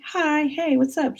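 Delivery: high, sing-song hello; cheery phone greeting. Retold or imitated. Answering friend's call, cheery greeting.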